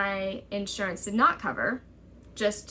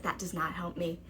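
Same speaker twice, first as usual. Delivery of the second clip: much softer, lower, stunned; quiet flat disbelief; 4 semitones lower, 8 dB quieter than usual. Retold or imitated. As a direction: say it stunned under your breath. Reacting to insurance's demand.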